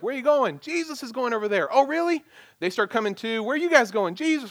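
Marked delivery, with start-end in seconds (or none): 0.0-2.2 excited townsfolk. Retold or imitated. Townsfolk asking the runners where they're off to, excited. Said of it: "the people along the way are like". 3.4-4.5 high, eager shouted question and answer; excited townsfolk. Retold or imitated. Runners passing word of Jesus down the road, excited. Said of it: "the people along the way are like".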